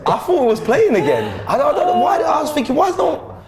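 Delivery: high, laughing self-mockery. Real, not acted. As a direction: giggle it out. Laughing at his mad moment.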